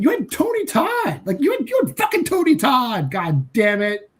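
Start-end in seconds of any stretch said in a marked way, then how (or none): 0.0-3.1 exasperated shout. Real, not acted. Reviewer yells at the filmmakers about wasting Tony Todd, exasperated. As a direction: yell it at the filmmakers.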